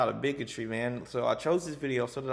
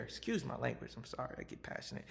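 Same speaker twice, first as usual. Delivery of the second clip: ordinary talk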